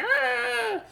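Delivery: very high silly play shriek; silly scream. Retold or imitated. Mom shrieks silly at toddler with alligator Lego, high squeal. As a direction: squeal it high to make the kid laugh. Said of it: "I was being silly".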